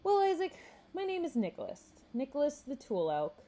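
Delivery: high, showy self-introduction; confident. A narrator's reading. Nicholas introduces himself to Isaac, confidently. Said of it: "Nicholas said with confidence".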